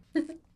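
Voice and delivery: short high, loud giggle. Real, not acted. Vlogger amused by tattooed handyman romance, giggle. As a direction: let out a quick high giggle.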